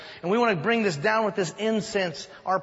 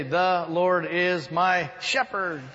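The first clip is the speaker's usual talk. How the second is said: slow recital; much slower than usual. Retold or imitated. Child reciting psalm.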